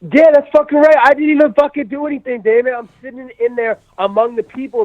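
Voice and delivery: high, loud protest of innocence; indignant outburst. Real, not acted. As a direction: insist loudly you did nothing. Indignant outburst about the ban.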